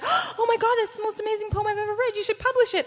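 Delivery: high-pitched imitation of an awestruck mother; gushing mom. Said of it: "and she was like".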